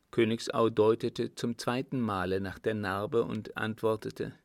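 usual speaking voice

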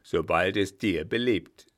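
loud, forceful villain; loud overbearing brother; domineering brother. A narrator's reading. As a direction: speak up hard, brooking no argument. Brother coldly threatens captive sister.

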